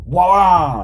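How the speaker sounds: short high loud "wah" yelp; mock yelp. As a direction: yelp the way you did when the punch landed. Retold moment of getting clocked.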